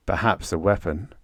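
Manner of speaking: plain reading voice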